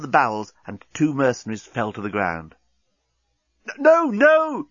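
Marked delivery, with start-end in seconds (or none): 3.8-4.7 very high, loud panicky cry; alarmed yell. A narrator's reading. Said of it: "yelled Corporal Inchby Wiglet in alarm".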